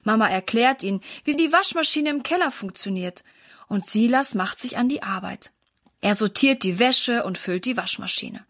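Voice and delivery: usual speaking voice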